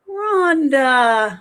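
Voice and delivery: ordinary talk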